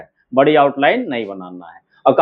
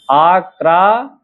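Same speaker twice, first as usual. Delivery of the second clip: slow आक्रा, split आ... Kra; drawn-out dictation of आक्रा; syllable by syllable; much slower than usual. Shown as a demonstration. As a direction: sound out आक्रा in two held pieces. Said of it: "अब पूरा लिखेंगे तो कैसे लिखेंगे".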